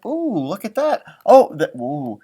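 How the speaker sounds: usual speaking voice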